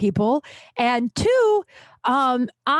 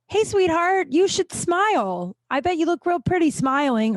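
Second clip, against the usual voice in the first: lightly voiced sleazy catcall, pitched up; a hint of a pushy bouncer, higher than usual; mock catcaller; 8 semitones higher than usual. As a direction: imitate a man hollering at a passing woman. Bouncer catcalls a passing woman.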